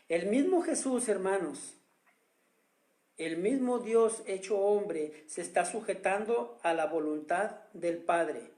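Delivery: normal talking voice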